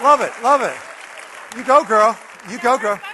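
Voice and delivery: loud, drawn-out hype; cheering encouragement. Real, not acted. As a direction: hype her up like a fan. Cheering an audience member on.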